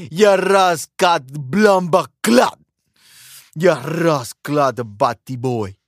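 put-on slow, drawn-out Jamaican accent; mock Jamaican patois. Host clowning after shout-out to Jamaican friends, mock Jamaican accent.

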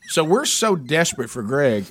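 ordinary talk

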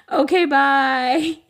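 slow, high, drawn-out farewell; sing-song goodbye. Real, not acted. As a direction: stretch out a cheery bye.

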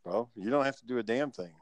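normal talking voice

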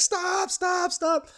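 very high panicked stop; high mock shriek of panic; screaming woman. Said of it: "She's like".

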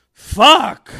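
sudden loud exclamation; blurted curse. Retold or imitated. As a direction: blurt it out in frustration. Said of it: "Just out loud".

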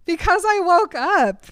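high, loud tearful wail; hammed-up sobbing protest; mock crying. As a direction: bawl it out like a heartbroken girl. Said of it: "why are you crying?".

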